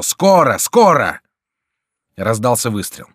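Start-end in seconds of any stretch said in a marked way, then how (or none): 0.0-1.2 high, loud threatening cry; roaring. A narrator's reading. Monsoreau threatens Bussy before firing, high loud roar. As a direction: roar the repeat menacingly. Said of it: "заревел Мансаро".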